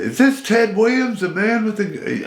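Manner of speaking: excited radio host. Retold or imitated. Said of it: "They said".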